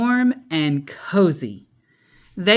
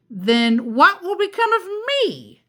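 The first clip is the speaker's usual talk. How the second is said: muttering witch; 10 semitones higher than usual. Acted.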